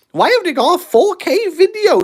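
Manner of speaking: high, whining mock demand; mock whiny viewer. Viewer demanding four K video, mock high whine. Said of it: "imagined viewer complaint".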